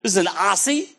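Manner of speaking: imitated amazed foreigners. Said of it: "They go".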